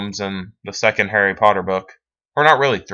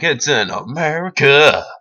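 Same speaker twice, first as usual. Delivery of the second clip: louder, higher, drawn-out dopey self-parody; mocking himself; 6 semitones higher, 5 dB louder than usual. Gamer mocks his own geography slip for viewers, dopey self-parody. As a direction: parody your own blunder, slow and loud.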